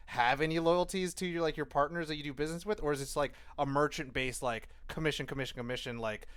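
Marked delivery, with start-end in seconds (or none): none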